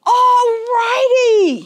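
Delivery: loud, high, long-drawn holler; bellow. A narrator's reading. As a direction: bellow it to rouse the whole town. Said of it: "he bellowed".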